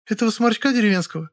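high, disdainful female voice; high-pitched snooty dismissal; scornful snort. A narrator's reading. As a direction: scoff in a high voice. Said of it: "фыркнула Лёля".

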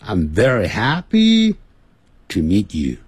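slow, with VERY and HAPPY stretched long; "I'm very happy" with lengthened vowels; drawn-out words. Shown as a demonstration. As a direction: draw out each word of "I'm very happy". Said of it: "它都拉得很长".

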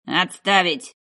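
loud commander's command; barked order. Acted. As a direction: bark it to halt the squad. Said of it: "рявкнул Кохт".

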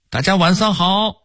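high-pitched Hong Kong-Taiwan pop singer greeting; Hong Kong-Taiwan accent. Retold or imitated. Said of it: "喜欢用港台腔儿说话".

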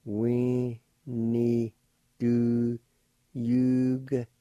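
We ni, do, yugta spoken in separate pieces; syllable by syllable. Shown as a demonstration. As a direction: build up the word piece by piece. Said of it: "like this".

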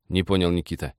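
plain reading voice

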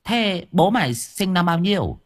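put-on high old granny voice; old woman asking. A narrator's reading. Old woman questions Long.

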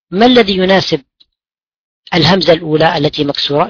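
ordinary delivery

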